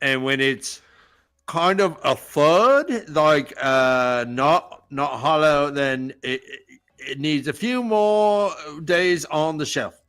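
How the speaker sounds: broad Aussie, slowing as he explains; hesitant produce expert in put-on Australian; Bondi Australian accent. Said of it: "Bondi B".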